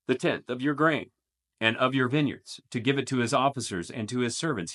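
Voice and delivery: measured, dramatic Bible reading; solemn scripture read to the congregation. Acted. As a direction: read the passage like a proclamation. Reads scripture passage aloud.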